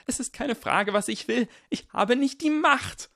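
high, loud cry of powerlessness; desperate. Acted. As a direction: build to a loud, despairing cry. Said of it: "seine Stimme so verzweifelt".

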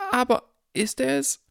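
put-on very high, sing-song old lady; trilling old woman. A narrator's reading. Old woman eager to see Harry, high trilling. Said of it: "trillerte eine alte Frau".

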